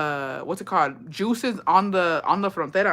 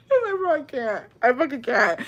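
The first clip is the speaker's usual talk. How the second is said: high shout of laughter, then gasping words; laughing; 8 semitones higher, 3 dB quieter than usual. Real, not acted. Telling friends about the third border check, cracking up. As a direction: crack up and barely get it out.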